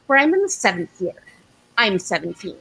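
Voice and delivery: ordinary talk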